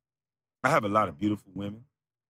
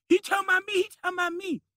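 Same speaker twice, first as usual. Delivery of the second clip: much higher whiny mock voice; mocking falsetto; faster than usual. Haters recording him, mimicked in high mocking falsetto. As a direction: sneer at them in a squeaky falsetto.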